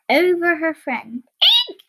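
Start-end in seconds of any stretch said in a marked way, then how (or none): 1.7-1.8 short startled "eek"; quick storybook squeal of surprise; surprised squeal. Acted. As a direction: squeal as if suddenly caught out. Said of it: "she squealed in surprise".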